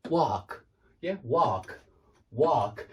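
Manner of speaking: "walk" with an open ah, like "wahk"; American vowel. Shown as a demonstration. Teacher tries Americans' version for students, "walk" as wahk. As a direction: attempt an American "walk", rhyming with "ark". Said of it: "exactly the same way as they say walk".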